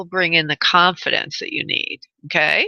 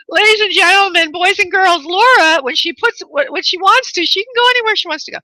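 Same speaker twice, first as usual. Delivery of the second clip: high, loud ringmaster proclamation; mock announcer; 9 semitones higher, 5 dB louder than usual. Host hyping Laura to the audience, mock announcer. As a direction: announce it grandly to the crowd, pitched high.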